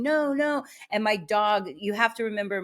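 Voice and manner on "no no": mild mock yell. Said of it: "I'm screaming".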